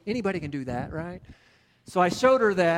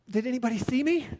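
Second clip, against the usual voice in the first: a hint of a mortified fiancée, pitched up; embarrassed question; 6 semitones higher, faster than usual. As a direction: ask whether anyone saw, lightly voiced. Fiancée after crash, embarrassed.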